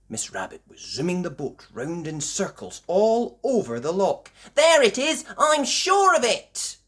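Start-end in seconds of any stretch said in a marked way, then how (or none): 4.5-6.8 high, loud excited cries; excited spotting. A narrator's reading. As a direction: call out each sighting at a high pitch. Passengers excitedly spot the monster.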